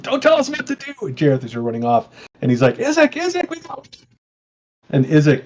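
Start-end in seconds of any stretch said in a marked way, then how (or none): 0.0-0.9 high-pitched yell at no real volume; mock yelling out. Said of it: "this guy back here is yelling out". 2.8-3.8 mock yelling out. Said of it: "this guy back here is yelling out".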